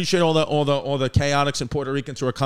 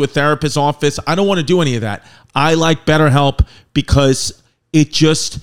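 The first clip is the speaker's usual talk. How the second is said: loud, punchy podcast ad read; sponsor ad read; 3 semitones lower, 5 dB louder than usual. Acted. Host reading the BetterHelp sponsor spot, loud ad voice. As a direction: sell it straight to the listeners, loud.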